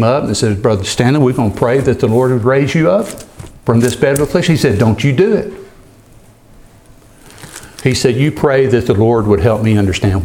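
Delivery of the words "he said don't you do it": ordinary talk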